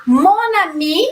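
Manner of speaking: mon ami, slow, the n linked into ami; slow liaison demo. Shown as a demonstration. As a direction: say mon ami slowly as mona-mi. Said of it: "la prononciation é la même chose". (pronúncia lenta de mon ami, ligando o n)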